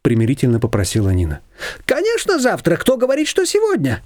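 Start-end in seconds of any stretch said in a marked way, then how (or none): 1.8-4.0 high, lively neighbour voice; a bossy older neighbour pitched up high; Shushana's voice. A narrator's reading. Neighbour brushing off Nina, high and bossy.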